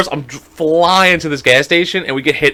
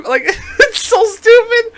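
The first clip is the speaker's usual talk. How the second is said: laughing; slower than usual. Real, not acted. Telling a friend about the car mishap, laughing high. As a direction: squeak it out high through laughter.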